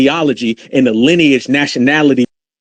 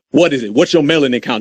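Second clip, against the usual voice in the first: usual speaking voice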